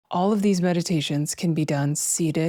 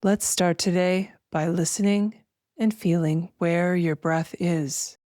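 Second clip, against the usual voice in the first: slow, spacious meditation voice with long pauses; guided meditation; slower than usual. Acted. Host guiding listeners to feel breath and weightless arms, slow meditation voice. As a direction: guide them gently through each breath, drawing words out.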